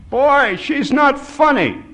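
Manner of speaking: very high, loud, slow complaint; protesting old man. A narrator's reading. Tearful old man scolds the laughing narrator, loud protest. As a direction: protest at the top of the range. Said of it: "he belched".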